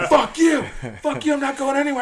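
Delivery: high-pitched mimicked refusal; worked-up, high protest; defiant yell. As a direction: imitate a stubborn man cursing. Defiant man refusing to leave.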